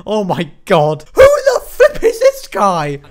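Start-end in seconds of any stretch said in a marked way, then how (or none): 1.1-3.0 high, slow incredulous squeal; much higher drawn-out shock; shocked shriek. Real, not acted. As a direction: squeal at the screen in disbelief. Shrieked disbelief.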